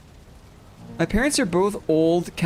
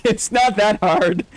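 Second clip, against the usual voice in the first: laughing quote; 2 semitones higher, 4 dB louder, slower than usual. Retold or imitated. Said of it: "says D K".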